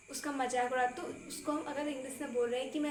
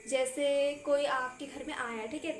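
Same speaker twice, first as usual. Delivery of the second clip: ordinary talk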